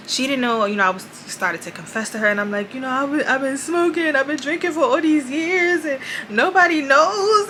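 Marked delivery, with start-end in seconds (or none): 2.7-7.5 higher, strained, shame pouring out; ashamed confession. Retold or imitated. Confessing to a family friend from church, ashamed and strained. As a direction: confess years of secrets, voice pitched up.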